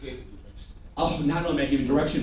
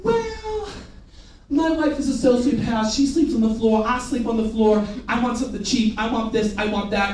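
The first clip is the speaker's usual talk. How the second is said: higher-pitched grumbling customer voice; complaining customer; 4 semitones higher than usual. Acted. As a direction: imitate a customer reeling off demands. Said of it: "They'll say things like this".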